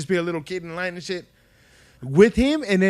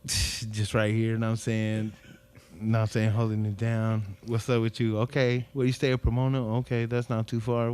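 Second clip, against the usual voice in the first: smooth party line talker; 7 semitones lower, 6 dB quieter than usual. Retold or imitated. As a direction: ease it out low like a teen mack. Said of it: "talking like the fools from the party line".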